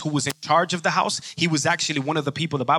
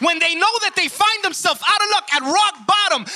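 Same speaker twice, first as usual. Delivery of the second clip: higher, driving sermon voice; impassioned preaching; 11 semitones higher, 4 dB louder than usual. Real, not acted. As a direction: build with urgent conviction. Preaching at full force.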